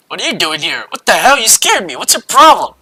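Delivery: high, loud, alarmed protest; startled outburst. Acted. Naked guy surprises him in the shower room, startled outburst. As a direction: blurt it out like someone given a fright.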